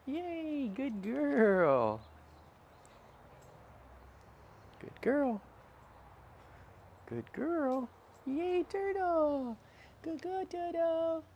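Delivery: very high, slow, gushing praise; high-pitched dog praise. Real, not acted. Praising dog during training.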